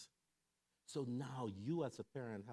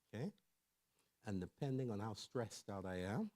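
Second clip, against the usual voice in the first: usual speaking voice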